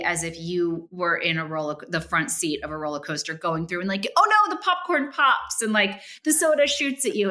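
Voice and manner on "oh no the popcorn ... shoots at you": much higher, louder hammed-up promo voice; cheesy ad voice. Guest recalls the cheesy roller coaster short before movies, hamming it up. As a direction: oversell it like a corny cinema short. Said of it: "it was so cheesy".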